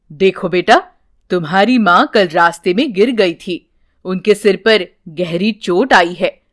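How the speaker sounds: put-on kindly holy man calming a girl; reassuring holy man. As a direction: soothe the child gently.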